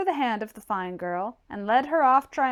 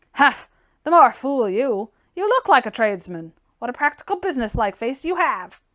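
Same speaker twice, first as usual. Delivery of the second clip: louder, snorting contempt from a gruff tradesman; hammed-up scornful humph and jeer; scoffing blunt man; 7 dB louder than usual. A narrator's reading. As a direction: scoff at him, a touch louder. Hunsden scoffs loudly.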